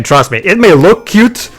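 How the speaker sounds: ordinary talk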